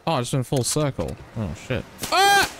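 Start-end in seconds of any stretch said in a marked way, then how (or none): 2.1-2.5 sudden high loud shriek; startled scream. Real, not acted. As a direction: yelp in sudden fright. Sudden fright in game.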